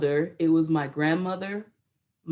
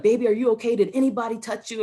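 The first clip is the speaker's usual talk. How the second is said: higher, urgent worried questions; panicked mother; 6 semitones higher, 3 dB louder, faster than usual. Retold or imitated. Mother to crying daughter on the phone, panicked. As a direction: act out mom's alarm, voice raised in pitch. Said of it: "And she panicked".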